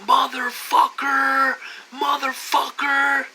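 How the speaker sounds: high, drawn-out mocking holler; imitated loud yell. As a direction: imitate a punk yelling, stretched and high. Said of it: "He yells ... Really loud".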